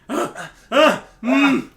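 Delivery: mock moan. Friend reading fanfic aloud, loud mock moaning. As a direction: overact a breathy fanfic moan.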